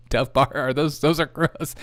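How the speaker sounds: ordinary delivery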